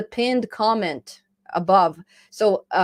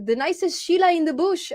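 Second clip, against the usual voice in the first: mock Australian; 9 semitones higher than usual. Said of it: "I think this is Australian".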